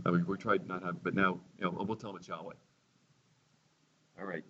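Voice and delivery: ordinary talk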